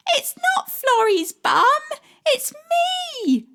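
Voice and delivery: put-on a shy child protesting loudly; boy correcting the dog; little boy crying out. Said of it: "cries a little boy".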